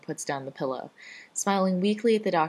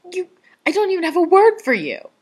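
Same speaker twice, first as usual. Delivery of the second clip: high, loud, sputtering outrage; spluttering yell; 12 dB louder than usual. A narrator's reading. Rodney yelling at his friend in the ER, outraged. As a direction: shout it, lost for words. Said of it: "Rodney yells at him".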